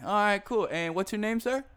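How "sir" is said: ordinary delivery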